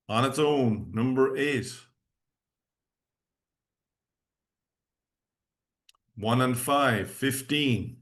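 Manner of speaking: slow, measured number calling; bingo caller. Acted. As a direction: call each number out clearly.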